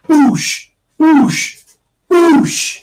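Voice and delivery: high, loud "boosh" kick noises; kick sound effect. Shown as a demonstration. Showing how to kick the window out, loud kick noises. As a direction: punch out each "boosh" like a kick through glass. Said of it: "donkey kick the window out".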